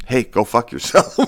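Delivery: put-on defiant brush-off; mock defiant retort. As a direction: toss it off mockingly as their comeback. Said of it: "They're like".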